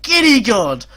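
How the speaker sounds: high, loud and stunned; breathless excitement. Real, not acted. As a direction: gasp it out in disbelief. Stunned after equaliser.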